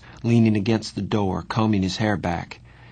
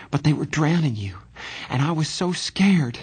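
fast and much higher, panicky; scared; 7 semitones higher than usual. Acted. Johnny explaining he panicked while his friend drowned, frightened. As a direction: blurt it out rushed and frightened.

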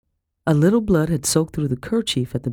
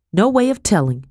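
Becky, matter-of-fact; 5 semitones higher, 4 dB louder than usual. A narrator's reading. Becky answers in narrated dialogue.

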